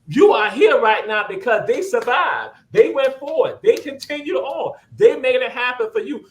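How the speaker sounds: impassioned preacher build; preaching. Real, not acted. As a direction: drive it home to the congregation.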